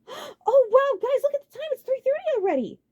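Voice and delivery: put-on very high, loud girlish surprise; startled by the clock; high flustered surprise; surprised Celia. A narrator's reading.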